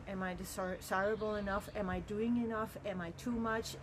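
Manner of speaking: usual speaking voice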